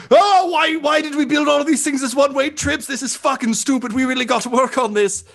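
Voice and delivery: very high, fast comic complaining; Moyles O'Brien. Acted. Intercom operator griping about one-way trips, high frantic complaint. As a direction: rant in a shrieky high voice, racing along. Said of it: "Moyles O'Brien is like".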